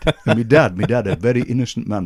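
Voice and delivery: ordinary talk, nothing marked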